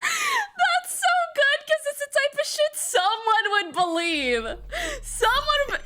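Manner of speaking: high laughing praise. Real, not acted. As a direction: talk while cracking up.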